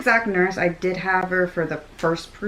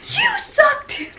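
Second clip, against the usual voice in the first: mock yell; slower than usual. Husband repeating his wife's insult to the nurse, mock yell. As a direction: throw the insult back, hammed up.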